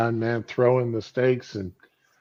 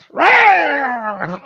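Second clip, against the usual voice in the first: sudden high, loud startled cry; startled yell; 13 semitones higher, 10 dB louder than usual. Retold or imitated. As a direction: jolt out a shocked "Rah!". Wife startled by bedroom light.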